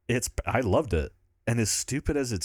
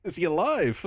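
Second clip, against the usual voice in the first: higher, mock German accent; hammed-up German accent with a raised pitch; 6 semitones higher than usual. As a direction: quote Dafoe in a German accent. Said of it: "but in the German accent".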